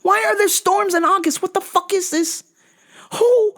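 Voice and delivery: very high, squeaky indignant voice; high mock outrage; shrill outraged squeal. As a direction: squeal it in disbelief at the sky. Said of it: "and I said".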